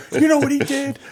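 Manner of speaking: high, loud tattling; hammed-up telling on a friend; mock outrage. Friend telling the household, mock indignant. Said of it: "Then he tells everybody".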